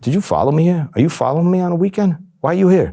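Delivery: imitating a mobster's mounting menace; retold angry accusation. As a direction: grill him like you caught a rat. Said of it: "Now it becomes intimidating".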